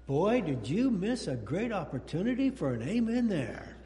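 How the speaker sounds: normal talking voice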